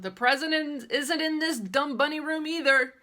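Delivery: slow, loud and high, put-on kid holler. Girl yelling from the living room about the missing present, slow loud holler. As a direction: bellow the complaint slowly. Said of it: "I hollered very loud".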